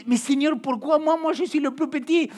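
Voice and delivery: beaucoup plus aigu et plus fort, presque crié; supplique paniquée. Retold or imitated. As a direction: crier sa détresse comme Gédéon. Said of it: "les paroles de Gédéon et dire". (Gédéon suppliant Dieu, aigu et fort)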